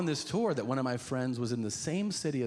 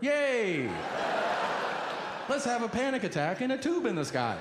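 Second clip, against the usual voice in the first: high-pitched mock cheer; sarcastic glee; 9 semitones higher than usual. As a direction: fake delight at a dreadful plan. Mock cheer about going to airport.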